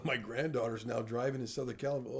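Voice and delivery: ordinary delivery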